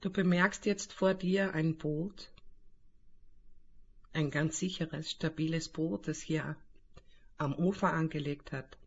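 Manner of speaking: calm guided meditation voice; right in your ear. Acted. Leitet eine Meditation an. (ruhige Meditationsstimme)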